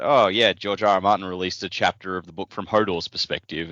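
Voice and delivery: usual speaking voice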